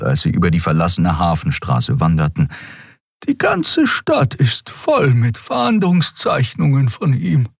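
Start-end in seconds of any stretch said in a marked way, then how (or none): 3.3-7.5 higher, slow puzzled voice; Xea musing. A narrator's reading. Xea wondering to Keru about the posters, slow higher voice. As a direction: keep puzzling it over.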